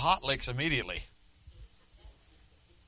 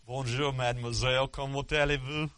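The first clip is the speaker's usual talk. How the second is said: mock French accent. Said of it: "My French name's Felix".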